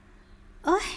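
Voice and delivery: short high cry of pain; soft pained ouch. Acted. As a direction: wince aloud. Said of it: "หล่อนครางเบาๆ".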